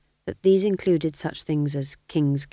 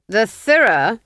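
louder, clipped aristocratic command; cold Duke; 5 semitones higher, 10 dB louder, slower than usual. Acted. Duke dismissing the sergeant: louder cold command. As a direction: dismiss him like a bored lord.

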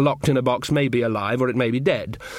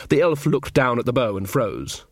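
plain reading voice

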